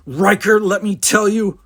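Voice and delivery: loud, high, furious outburst; sputtering with anger. A narrator's reading. Stinson rounds on Riker, sputtering with anger. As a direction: start an angry telling-off. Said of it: "sputtering and turned red with anger".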